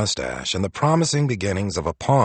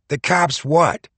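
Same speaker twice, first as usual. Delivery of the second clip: put-on Boston accent; 8 semitones higher, 4 dB louder, slower than usual. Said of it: "a deep, thick Boston accent said".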